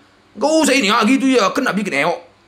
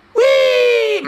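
surprised yelp. Real, not acted. Player sees a big win, high surprised yelp. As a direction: squeal at the win.

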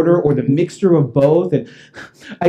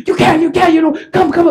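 high, flustered protest; frantic objection; 13 semitones higher, 4 dB louder than usual. Retold or imitated. Police stopping homeowner going to the garage, frantic. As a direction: try to stop him, high and stammering.

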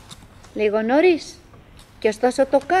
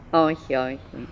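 muttered denial; 10 semitones lower, 7 dB quieter than usual. Acted. Quietly denying to herself.

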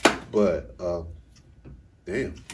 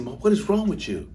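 higher, louder mock outrage; indignant confrontation; 8 semitones higher, 6 dB louder than usual. Child confronts mom for hurting the niece, indignant. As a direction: call Mom out in a raised, imitated voice. Retold confrontation, restarted with more force.

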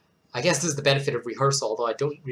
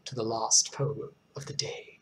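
whisper; 4 semitones lower, 7 dB quieter than usual. Real, not acted. Warning squeamish viewers before dark poem.

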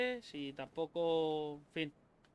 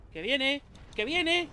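panicked alarm; 8 semitones higher, 7 dB louder, slower than usual. Real, not acted. Enemy charging at him.

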